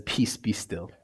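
low, soft and steady; calm command. Acted. Jesus speaking to the storm, low and calm. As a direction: speak peace over the storm. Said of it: "He stands before the storm and he says".

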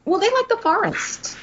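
high silly voice; put-on voice. Cohost jokes about what they like, high silly voice. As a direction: pitch it way up as a joke. Joking voice of the creatures.

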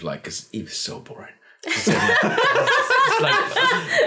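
shrieking high laugh breaking into a word; burst of laughter. Real, not acted. Telling the friends a funny story, high shrieking laugh.

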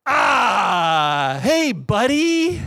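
drawn-out stalling greeting, voice sliding high; long awkward "ah", then high slow hello. Retold or imitated. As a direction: cover for a forgotten name. Acting his awkward forgotten-name reply.

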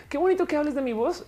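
flattered giggle while talking; laughing delight. Real, not acted. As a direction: laugh it out, touched by the compliment. Flattered by a viewer's comment.

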